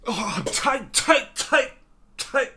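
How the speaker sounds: very high, loud, excited repetition; Tuco impression. Vlogger imitating Tuco's wired outburst, very high and loud. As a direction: squeal the word like a wired thug. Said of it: "I think that was pretty good".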